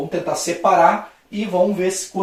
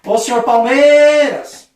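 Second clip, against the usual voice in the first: loud, high, drawn-out shout of a name; shouted call stretching the stressed syllable; 7 semitones higher, 9 dB louder, slower than usual. Shown as a demonstration. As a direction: holler for someone outside their house. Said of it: "Eu iria gritar assim".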